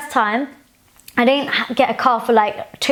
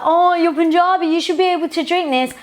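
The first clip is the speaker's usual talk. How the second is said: lightly voiced pushy crowd, pitched higher; mock peer pressure; 6 semitones higher than usual. People pressuring a Punjabi to drink, mock goading. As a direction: imitate people egging you on, higher voice.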